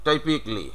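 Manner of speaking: "টাইপিকলি" in three syllables, taipik-li; correct "typically". Shown as a demonstration. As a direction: say "টাইপিকলি" with no "kaa". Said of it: "ওরা কিন্তু এভাবেই উচ্চারণ করবে".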